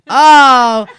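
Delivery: loud, high, exaggerated dawning realization; mock realization. Humoring her partner's bad pun, loud mock realization. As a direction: play up suddenly getting the joke.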